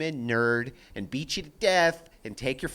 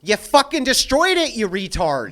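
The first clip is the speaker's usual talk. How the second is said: angry shout; 6 semitones higher, 10 dB louder than usual. Retold or imitated. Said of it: "I'm like".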